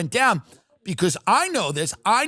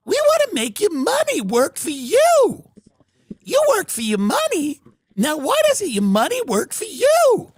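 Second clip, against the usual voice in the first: put-on much higher, louder pleading man-child; creepy childlike Epstein; 11 semitones higher, 4 dB louder than usual. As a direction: squeak high to keep him on the line. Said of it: "childlike voice".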